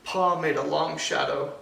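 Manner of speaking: ordinary delivery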